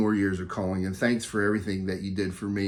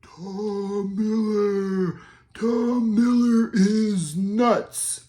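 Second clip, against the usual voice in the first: much higher, very slow, eerie ghostly wail; 10 semitones higher, 3 dB louder, much slower than usual. Pretend. Speaking into Tom's tape recorder at the bridge, ghostly wail. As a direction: moan it like a spirit on the tape.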